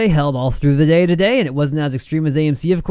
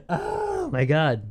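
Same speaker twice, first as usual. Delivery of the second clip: slow giddy disbelief; 4 semitones higher, 6 dB quieter, much slower than usual. Real, not acted. After laughing at targets.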